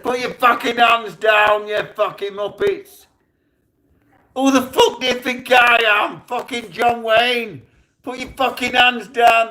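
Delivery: high, loud, aggressive command; furious shouting. Retold or imitated. Gunman ordering the crew's hands down, furious shouting. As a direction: bellow it at them, re-enacting the rage. Retold shouting at crew at gunpoint.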